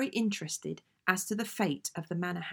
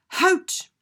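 sudden yelled Hout, far higher and louder; a full-voiced tennis call with the H added; dropped-H shout; 13 semitones higher, 11 dB louder than usual. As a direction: bellow it across the tennis court. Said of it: "I shouted".

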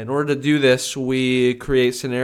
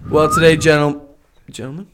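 peppy orientation leader; 4 semitones higher, 7 dB louder than usual. Acted.

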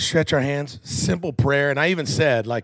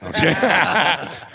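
laughing; 5 semitones higher, 4 dB louder than usual. Real, not acted. Jokes about Christy's age.

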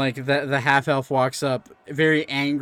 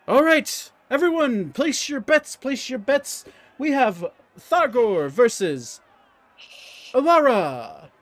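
high, loud ringside calling of bets; announcer; 10 semitones higher, 4 dB louder, slower than usual. Acted. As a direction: hype the crowd like a bout announcer. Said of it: "half-elf walks up and he's like".

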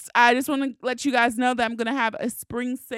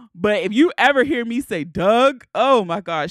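usual speaking voice

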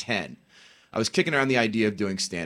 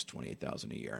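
much lower, much softer, crushed; defeated mumble; 12 semitones lower, 16 dB quieter than usual. Retold or imitated. Fired man answers Clooney in a crushed low voice. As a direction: let it fall out of you, beaten. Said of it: "looks at it and goes".

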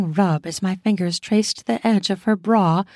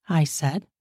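ordinary delivery, nothing marked

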